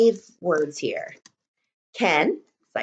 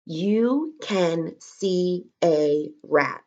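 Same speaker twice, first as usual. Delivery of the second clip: slow dictation; 3 semitones lower, much slower than usual. Shown as a demonstration. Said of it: "Press pause".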